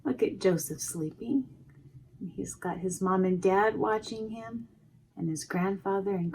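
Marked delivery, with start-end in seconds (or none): none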